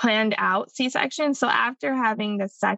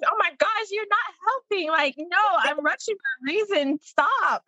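exasperated mom; 8 semitones higher than usual. Retold or imitated. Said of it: "And I'm like".

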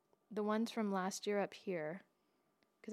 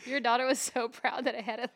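louder, amused, laughter in the words; laughing; 3 semitones higher, 7 dB louder than usual. Real, not acted. Telling friend about her proud daughter, laughing. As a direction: tell it while still laughing. Laughing as she tells story.

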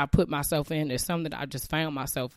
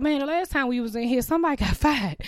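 nervous staff; 9 semitones higher, faster than usual. Retold or imitated. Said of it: "They was like".

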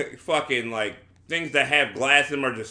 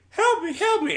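much higher, thin mock plea for help; imitated scream for help. Tied-up girl begging for rescue, a high mock scream. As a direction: squeal it like a captive girl, half in jest. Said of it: "the girl starts screaming".

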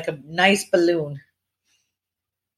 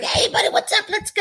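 jittery hyped-up greeting, much higher; hyper. Retold or imitated. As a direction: bounce in, over-caffeinated. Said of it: "you're gonna be like".

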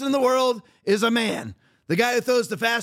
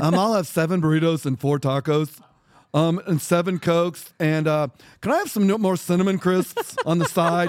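a lightly put-on feminine voice, lower and unlike his own, ordering fast food; mock girly voice; 6 semitones lower than usual. Shown as a demonstration. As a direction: play at sounding like a girl while ordering. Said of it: "I can talk like that right now. Do I sound like a girl?".

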